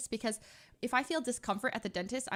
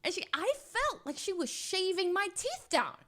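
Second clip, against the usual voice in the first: disbelieving; 8 semitones higher than usual. Real, not acted. Telling of painful dental polishing.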